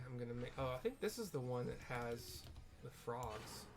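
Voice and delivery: ordinary delivery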